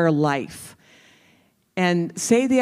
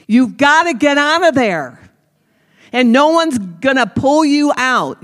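higher and louder, urgent push; fiery exhortation; 5 semitones higher, 3 dB louder than usual. Real, not acted. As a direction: drive it home to the crowd. Preacher's sudden exhortation.

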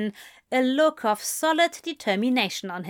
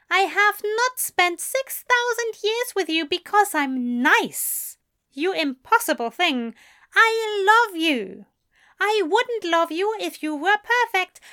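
fervent declaration; 9 semitones higher, 5 dB louder than usual. A narrator's reading. Angel's fervent loving reprimand.

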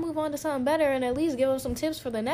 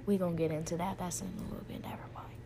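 much softer, lower, trailing to a whisper; hushed self-dismissal; muttered aside; 6 semitones lower, 14 dB quieter, much faster than usual. Real, not acted. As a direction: back off the topic under your breath. Cuts herself off, aside.

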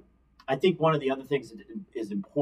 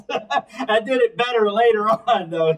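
laughing; 8 semitones higher, 5 dB louder than usual. Real, not acted. Laughing about her announcer voice.

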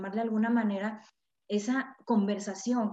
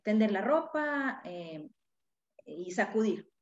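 ordinary talk, nothing marked

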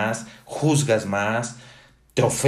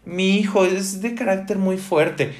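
high mock voice of a proud parent; lightly hammed-up parent bragging about their child; 9 semitones higher than usual. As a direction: parent boasting that their son is tough, voiced higher in mockery. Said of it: "cuando dicen".

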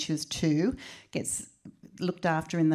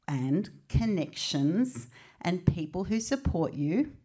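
normal talking voice

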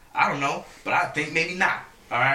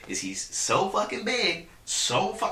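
ordinary talk

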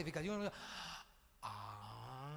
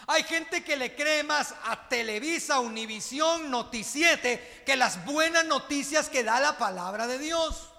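fervent sermon delivery; preaching; 3 semitones higher, 6 dB louder than usual. Acted. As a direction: press the point to the church. Preaching to the congregation. (predicando con fervor)

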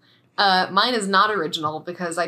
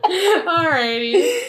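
high, slow, drawn-out laughing alrighty; 8 semitones higher, 5 dB louder, much slower than usual. Real, not acted. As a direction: stretch it out through giggles. Laughing sign-off.

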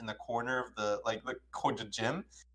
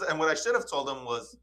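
ordinary talk, nothing marked

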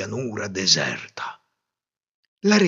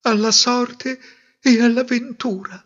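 to herself; 12 semitones higher, 3 dB louder than usual. A narrator's reading. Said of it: "si disse".

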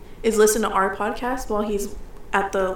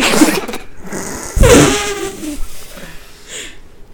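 long breathless nervous laughter; nervous giggle; 10 semitones higher, 6 dB louder than usual. Real, not acted. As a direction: giggle helplessly out of nerves. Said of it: "This is her nervous laugh".

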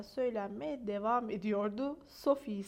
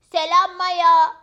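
high, very loud, slow greeting; Fülfül the dog; 5 semitones higher, 17 dB louder, slower than usual. A narrator's reading. Fülfül meets friend Maya, loud high greeting. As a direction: call out an eager hello in a high dog voice. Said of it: "dedi Fülfül".

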